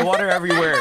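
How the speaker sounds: ordinary talk, nothing marked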